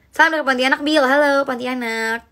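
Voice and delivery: normal talking voice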